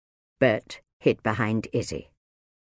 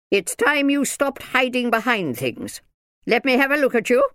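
high, loud ordering voice; stern minder, bossy; 13 semitones higher, 5 dB louder than usual. A narrator's reading. Minder tells hiding Bert to come out, bossy. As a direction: order the child out, pitched up.

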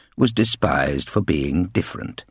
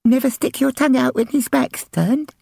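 put-on high, lisping small child; lisping little girl. Little sister questioning the bullies, innocent lisp. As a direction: ask it with a little girl's lisp. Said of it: "suggested Lizzie".

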